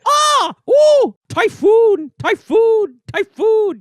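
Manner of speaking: high, loud video game attack cries; distressed battle shouts. Retold or imitated. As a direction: yell each move like a fighting game hero. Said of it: "she always sounds like she's in distress".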